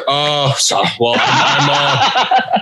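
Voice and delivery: low, halting mumble; uncommitted promo. Retold or imitated. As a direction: imitate a half-hearted wrestler fumbling his promo. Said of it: "exactly how you were cutting the promo".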